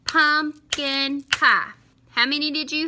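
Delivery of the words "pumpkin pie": "Pumpkin pie" in three slow, loud beats, pitched up; syllable by syllable. Shown as a demonstration. Teacher counting syllables with pupils, syllable by syllable. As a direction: chant "Pumpkin pie" slowly as pump, kin, pie. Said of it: "So let's count those syllables".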